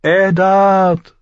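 high, loud drawn-out call; crying out. A narrator's reading. Lyanna screaming to Eddard through the clash of steel, crying out. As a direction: scream the name across a battle. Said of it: "hörte er Lyanna schreien".

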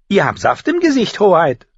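high, mocking little sister; jeering. A narrator's reading. Younger sister mocks the juice-splattered future queen, jeering. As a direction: taunt her in a high voice, loud on the last word. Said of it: "höhnte Arya".

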